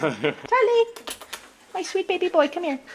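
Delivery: high, sing-song pet talk; cooing baby talk. Acted. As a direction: coo to a beloved pet.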